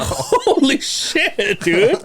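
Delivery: drawn-out, high, laughing shock. Retold or imitated. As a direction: stretch it out in laughing disbelief. Retold shocked reaction, laughing.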